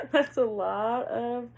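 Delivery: loud, slow words through laughter; laughing. Real, not acted. Reader amused by the dominant male's ego death, laughing. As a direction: crack up while saying it, high and loud. Cracking up at own remark.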